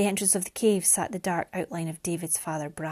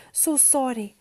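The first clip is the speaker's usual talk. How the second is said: higher, small, remorseful voice; sorrowful apology; 4 semitones higher than usual. A narrator's reading. Mariana apologises to bereaved father, sorrowful. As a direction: let the apology sink helplessly.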